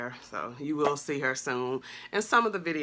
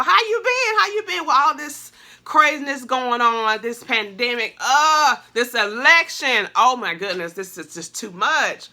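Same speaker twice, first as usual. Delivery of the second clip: excited outburst; 8 semitones higher, 7 dB louder than usual. Real, not acted. Greeting viewers, pandemic and election.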